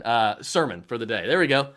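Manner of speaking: ordinary talk, nothing marked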